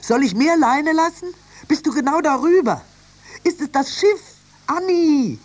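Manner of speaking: high, urgent captain's yelling; frantic shouting to the lookout. Acted. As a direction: fire off loud, excited questions. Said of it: "schrie der Kapitän".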